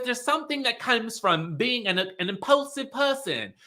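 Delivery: put-on Dorit's accent. Recapping Dorit explaining dopamine to Lisa, lightly mock Dorit's accent. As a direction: lightly mimic Dorit's accent, trailing off into blahs. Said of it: "Dorit in that accent".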